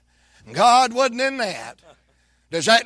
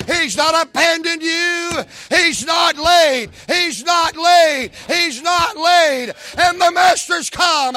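preaching at full force; 5 semitones higher than usual. Acted. Preacher shouts that God has not forgotten them. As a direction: build the congregation up.

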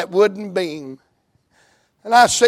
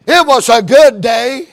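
louder, rising preached repeat; emphatic proclamation; 5 semitones higher, 8 dB louder than usual. Shown as a demonstration. Preacher repeating scripture to the congregation, louder and rising. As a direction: echo the line as a ringing proclamation. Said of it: "And it was a good day".

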